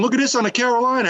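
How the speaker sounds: quick, high-pitched mock bragging; hammed-up imitation, rattled off; running his mouth. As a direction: replay the mouthy angler. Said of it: "he's running his mouth".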